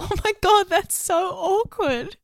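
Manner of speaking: high-pitched, drawn-out cringe; high, teasing dismay; appalled reaction. Real, not acted. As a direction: squeal at how awkward it is. Reacting to sister's pointless woohoos.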